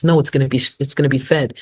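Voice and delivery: normal talking voice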